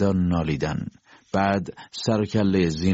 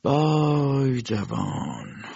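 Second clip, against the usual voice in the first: slow, stretched mournful sigh of a word; slow pitying lament; drawn-out lament; 6 semitones higher, much slower than usual. A narrator's reading. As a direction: let the vay hang, pitying. Doctor sighs over the youth.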